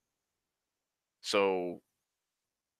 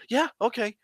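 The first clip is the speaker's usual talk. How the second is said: voiced much higher as Jess, eager; chirpy girlfriend agreeing at once; imitating Jess; 14 semitones higher than usual. Jess answering on the phone.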